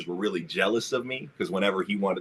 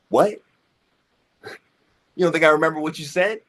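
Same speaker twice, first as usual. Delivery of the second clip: higher, louder, fast incredulous jab; incredulous challenge; 7 semitones higher, 4 dB louder, faster than usual. Retold or imitated. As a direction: fire it back in disbelief. Confronting the bully.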